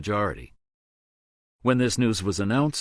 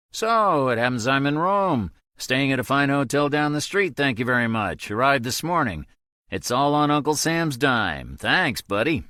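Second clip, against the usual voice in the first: higher, louder teasing Toby; sarcastic friend voice; 6 semitones higher, 4 dB louder than usual. A narrator's reading. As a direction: needle him with mock gratitude.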